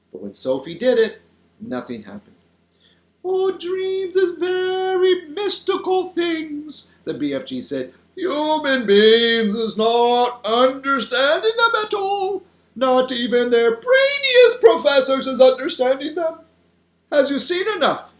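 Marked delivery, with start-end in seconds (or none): 3.2-6.9 high, slow, singsong giant voice; big friendly giant. A narrator's reading. The giant explaining dreams to Sophie, mystical. 8.1-18.1 high, loud, drawn-out giant voice; big friendly giant. A narrator's reading. The giant explaining dreams to Sophie, emphatic.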